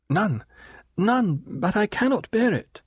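put-on high, pleading protest from a frightened girl; terrified plea. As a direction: beg him to stop, high and anxious. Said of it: "her terrified expostulation".

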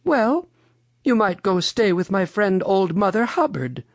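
high-pitched helpful storybook lady; Mother Goose. A narrator's reading. Mother Goose suggests a friend.

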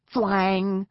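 high, loud twanging noise; arrow twang. A narrator's reading. Narrator as an arrow flies past, loud high twang. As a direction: voice a sharp "thwang".

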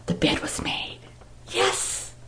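whispered suspense; hushed whisper. A narrator's reading. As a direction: breathe it out in a hush. Hushed story moment.

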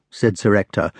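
ordinary delivery, nothing marked

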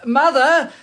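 put-on loud, high and slow, so a deaf old woman can hear; loud, slow and high for the deaf mother. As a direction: call each word out clearly to her. Introducing lord to deaf mother.

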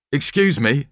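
polite. A narrator's reading.

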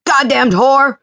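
high, loud, raging shout; furious yell. Acted. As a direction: roar after her in fury. Villain yells after fleeing woman.

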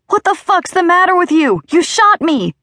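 high, pained yell of disbelief; outraged yelling. A narrator's reading. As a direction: yell at the man who just shot you. Shot man rages at shooter.

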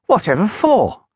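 high, puzzled little engine question. Acted. Little engine puzzled by Gideon's job, high voice. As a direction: ask it high and baffled. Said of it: "asked Little Titch, puzzled".

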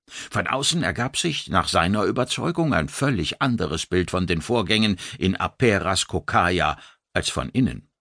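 ordinary delivery

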